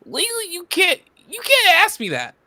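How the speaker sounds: high, loud protest; mock outrage. Player told not to use a word, mock outrage. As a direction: object at the top of your range, hammed-up.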